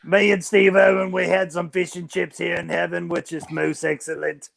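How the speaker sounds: hammed-up Australian Jesus; Australian accent. Jesus recalling welcoming Steve Irwin to heaven, Australian accent. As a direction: put on a loose Australian accent. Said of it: "I can do an Australian accent too".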